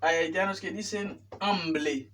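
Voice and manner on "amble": "hambre" said as "amble"; "hambre" mispronounced with L replacing R; learner's L for R. Retold or imitated. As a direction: imitate the mistake, "hambre" with L after b. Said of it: "No es hambre".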